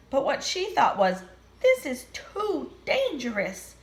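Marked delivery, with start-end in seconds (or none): 1.6-3.7 nervous sheep voice. Acted.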